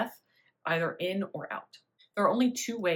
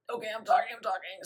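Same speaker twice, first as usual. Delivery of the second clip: 1 held-air talking; 4 semitones higher, faster than usual. Shown as a demonstration. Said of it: "I'm holding air with my vocal cords".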